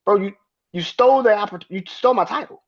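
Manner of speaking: mock outraged Cody; imitating Cody. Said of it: "he's just like".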